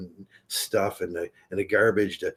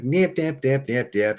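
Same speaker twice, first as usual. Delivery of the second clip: mock nagging; 4 semitones higher than usual. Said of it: "She was just yelling".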